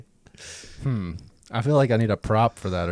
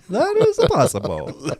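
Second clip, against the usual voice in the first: high, slow laughing words; 12 semitones higher, 4 dB louder, slower than usual. Real, not acted. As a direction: giggle through the phrase. Laughing repeat of phrase.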